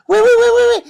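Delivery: high, loud run of oui; excited. Real, not acted. Hyped yes during sponsor read.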